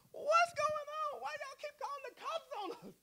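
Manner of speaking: innocent high voice. Retold or imitated. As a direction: squeak it out like a harmless little man. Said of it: "that's exactly how it was".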